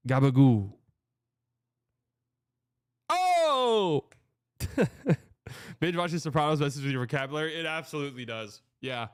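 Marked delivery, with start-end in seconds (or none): 0.1-4.0 loud, hammed-up Italian-American exclamation; mock Italian-American accent. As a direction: belt it out like a mock Italian-American mobster, the "Oh!" high. Mimicking Sopranos Italian-American catchphrase.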